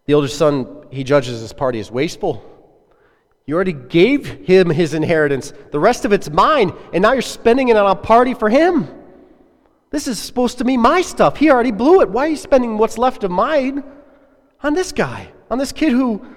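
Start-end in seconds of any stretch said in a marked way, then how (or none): 3.5-13.8 rising, louder, higher resentful protest; a son boiling over about his inheritance; resentful outburst. Acted. Older son's angry outburst. 14.6-16.2 higher pitched scornful jab; contemptuous jab. Acted. Older son's angry outburst.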